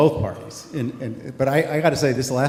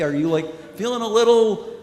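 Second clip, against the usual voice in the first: a higher, half-joking probing ask; mock delicate question; 6 semitones higher than usual. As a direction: pretend to ask the pilot gingerly. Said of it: "should I ask her maybe".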